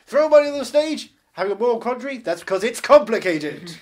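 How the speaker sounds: very loud mock MC patter; hammed-up compere working the crowd; strip club announcer. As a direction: shout each film pun to the back of the club. Continuing the strip club MC act.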